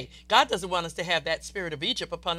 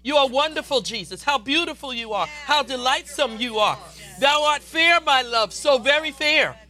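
rising, louder and louder adoration; overflowing praise; 4 semitones higher, 5 dB louder than usual. Shown as a demonstration. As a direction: pour out praise to Jesus. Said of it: "The words come easily".

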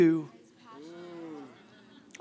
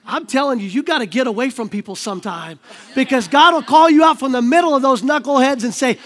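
ordinary talk

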